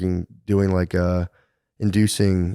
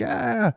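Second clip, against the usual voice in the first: mock child's gasp; 14 semitones higher, 4 dB quieter than usual. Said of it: "the kid was like".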